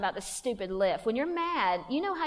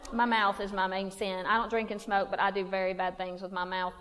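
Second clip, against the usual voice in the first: usual speaking voice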